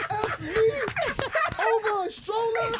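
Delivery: very high, wounded disbelief; mock hurt. Husband wounded in stroller argument, mock-tearful. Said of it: "one single tear dropped down".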